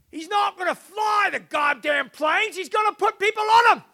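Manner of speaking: very high, loud strained shout; hammed-up outraged shouting; mock angry yell. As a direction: yell it like a boss heard through a wall. Boss overheard yelling through the wall.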